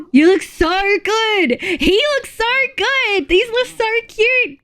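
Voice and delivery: high, stretched-out gushing; adoring squeal. Real, not acted. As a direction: squeal each compliment slowly, way up high. Gushing over friends' photos.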